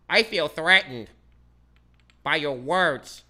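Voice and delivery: slow, mocking whine of a thin-skinned politician; mock whiny politician. Mocking politicians who can't take insults, in a slow whiny voice. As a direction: drawl it out like a wounded official.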